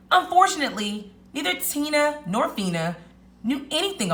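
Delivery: animated storytelling with lilting pitch and stressed key words; lively picture-book narration; story read-aloud. A narrator's reading. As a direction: read it to young children, savoring each big moment. Reads story to children.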